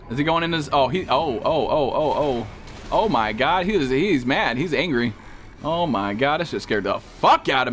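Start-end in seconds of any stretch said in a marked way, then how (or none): none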